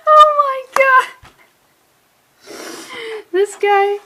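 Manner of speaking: high, loud disbelieving squeal; loud high-pitched shock. Real, not acted. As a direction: shriek in disbelief. Shocked by comic panel.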